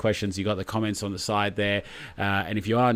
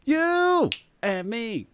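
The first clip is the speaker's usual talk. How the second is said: teasing; 13 semitones higher, much slower than usual. Pretend. Joking banter, drawn out.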